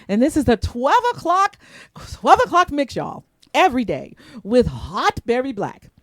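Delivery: high, loud radio hype for the mix show; hyped emphasis. Shown as a demonstration. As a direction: sell it like a DJ drop. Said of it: "I gotta put some emphasis on that".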